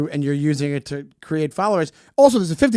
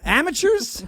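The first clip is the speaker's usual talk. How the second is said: mocking; 10 semitones higher, slower than usual. High mocking voice.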